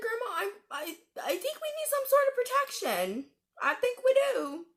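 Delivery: anxious pleading. Retold or imitated. To her grandma, insisting on protection: high, anxious pleading. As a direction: beg her grandma, voice climbing up tight.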